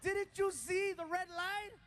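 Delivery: high, slow, loud disbelief; incredulous yelling. Retold or imitated. Passenger scolding the driver, slow incredulous high yell. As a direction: spell it out to him, high and slow.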